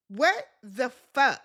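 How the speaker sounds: slow, drawn-out what the fuck; stunned slow what the fuck; shocked disbelief. Real, not acted. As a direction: let the shock stretch the words. Shocked reaction to the fight.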